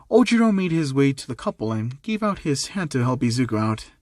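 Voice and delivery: usual speaking voice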